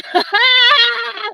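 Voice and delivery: high-pitched laugh; long high cackling laugh. Real, not acted. Laughing after salad comment.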